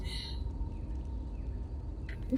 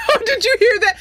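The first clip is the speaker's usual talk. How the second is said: excited; 13 semitones higher, 4 dB louder, faster than usual. Real, not acted. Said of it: "excited over voice acting".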